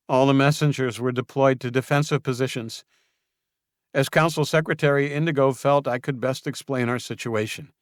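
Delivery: high, loud quick excuse; Julian explaining. Acted. Julian explaining himself to Luke, high and hurried. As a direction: rush the explanation.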